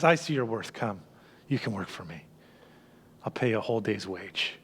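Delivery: hushed, kind invitation; gentle owner voice. Acted. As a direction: draw them in tenderly and quietly. Owner voiced in sermon parable.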